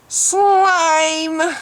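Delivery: a sharp, disgusted insult; contemptuous outburst. Real, not acted. Insulting the video maker.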